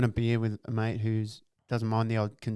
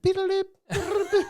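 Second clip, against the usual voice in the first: high mock robot beeps through a laugh; robot scanning noise. Retelling the Queen scanning an intruder, high robotic beeps. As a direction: beep like a scanning machine, laughing. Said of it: "She looked at him, went".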